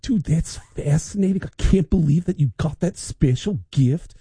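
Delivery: a hint of an impressed Lars; Lars impression. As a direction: play Lars, amazed and a bit slow. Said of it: "he's like".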